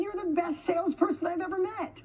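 high-pitched retold exclamation; mock amazement, pitched well up; amazed praise. Husband praising his wife as best salesperson, high-pitched amazement.

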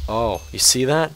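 normal talking voice

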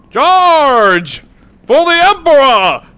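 loud, high, drawn-out war cry; rally the troops as you charge; battle cry. Pretend.

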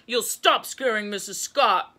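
loud, stern command; booming telling-off; angry roar. A narrator's reading. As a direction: roar out the order. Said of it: "roared Gus".

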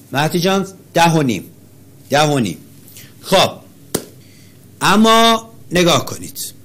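ordinary talk

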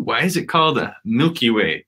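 ordinary talk, nothing marked

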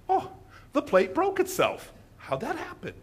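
high, innocent mock puzzlement; feigned innocence. Dinner guest excusing a dropped plate, feigned innocence. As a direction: act baffled by your own accident.

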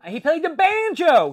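loud, high burst of sudden recall; excited outburst. Real, not acted. Suddenly remembers the detail.

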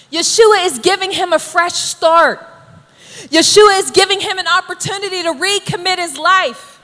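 normal talking voice

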